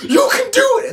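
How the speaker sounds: very high, loud mic yell; mock yelling. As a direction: scream it into a microphone. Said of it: "someone yelling into a microphone".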